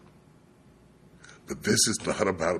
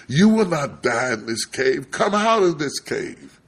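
raised voice, slowing into a summons; commanding God; 8 semitones higher, 4 dB louder than usual. Retold or imitated. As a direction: call Elijah out with authority. God commands Elijah in preacher's retelling.

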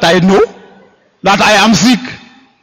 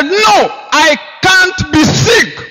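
high, slow, drawn-out declaration; defiant shout; 13 semitones higher, much slower than usual. Retold or imitated. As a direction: proclaim it with defiance.

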